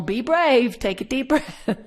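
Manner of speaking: put-on high, loud little-girl voice; a small child bossing her toy; imitating a toddler. Toddler comforting her stuffed animal, high and loud. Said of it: "tell her little stuffed animal".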